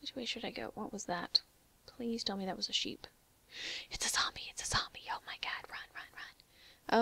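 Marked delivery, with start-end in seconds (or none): 3.9-7.0 frantic breathy whisper; panicked whisper. Real, not acted. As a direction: whisper in a panic while fleeing. Zombie attack, fleeing.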